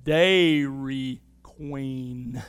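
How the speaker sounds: very slow, low Southern drawl; pronounced southern drawl. Retold or imitated. Waitress sounding out the name for tourists in a very slow Southern drawl. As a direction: stretch each syllable out in a deep Southern drawl. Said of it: "she said in this pronounced southern drawl".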